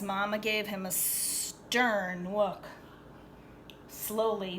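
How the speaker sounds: plain reading voice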